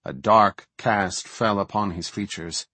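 ordinary delivery, nothing marked

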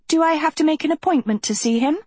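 high, rapid insistent question; pressing. A narrator's reading. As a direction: press for an answer, fast and high. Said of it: "pressed Sophie".

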